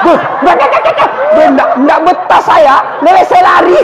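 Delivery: a spirit fleeing in a panic; panicked jin. Retold or imitated. Jin kabur dari sumur. (jin panik berteriak ingin lari)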